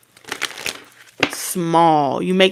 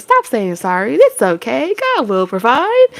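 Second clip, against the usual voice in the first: forced sweet reassurance; 5 semitones higher than usual. Pretend. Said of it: "supposed to be like".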